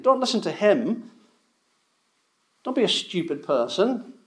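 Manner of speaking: preacher mocking the Pharisees who scorn the common people, sneering; mock haughty Pharisee. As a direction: sneer down at the crowd as a smug Pharisee. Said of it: "In other words".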